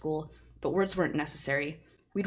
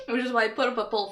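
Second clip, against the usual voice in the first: laughing; 6 semitones higher, faster than usual. Real, not acted. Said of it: "I'm sorry".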